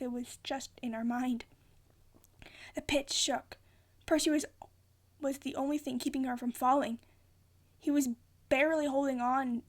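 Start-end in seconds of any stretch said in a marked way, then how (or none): none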